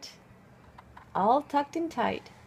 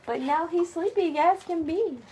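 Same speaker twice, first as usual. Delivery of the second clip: ordinary delivery, nothing marked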